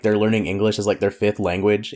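normal talking voice

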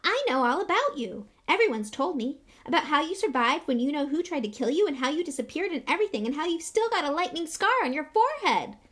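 put-on high, loud, eager boy; Colin Creevey. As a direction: rattle it off like a besotted fan. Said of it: "Creevey eagerly, edging further forward".

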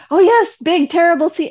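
high, loud hammed-up fear of the sea; hammed-up mock alarm; mock dramatic townsfolk. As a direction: play up the big terrible sea. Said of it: "everyone was like".

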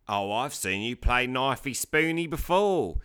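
slow, exaggerated Aussie-Cockney accent; mock a bad cartoon Australian accent; drawn-out, stupid Cockney-tinged Aussie voice; Simpson-esque Australian voice. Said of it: "talking in this, like, Simpson-esque sort of voice".

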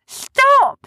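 very high, loud character cry; high-pitched pleading yell; desperate shout. Acted. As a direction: yell to halt a passing ship. Sniz begs passing ships to halt.

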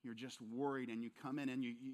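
usual speaking voice

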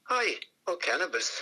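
high "Aye" in imitated Scottish accent; Willie Johnson. Joking legend answers after a pause, imitated Scottish accent. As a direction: deliver the punchline in a Scottish accent. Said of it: "he says".

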